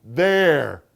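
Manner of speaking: loud, high triumphant "There". A narrator's reading. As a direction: she thinks she has won. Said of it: "her eyes flashing triumph".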